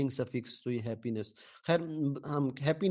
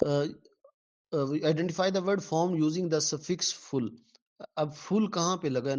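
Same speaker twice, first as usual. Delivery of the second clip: normal talking voice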